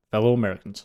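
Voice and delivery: low, soft presidential address; a quick, understated politician impression; Barack Obama. Cohost asks for a quick impression, understated presidential voice. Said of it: "Do your Barack Obama really quick".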